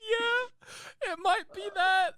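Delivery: high, loud squeal through laughter; helpless laughing apology. Real, not acted. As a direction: wail it out while cracking up.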